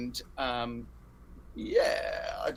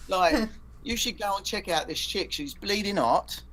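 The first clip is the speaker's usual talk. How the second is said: higher, hammed-up Cockney accent; mock Cockney; 6 semitones higher than usual. Shown as a demonstration. [name] jokingly switching to Cockney accent. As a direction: slip into a jokey London Cockney. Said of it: "maybe I should have done a different accent".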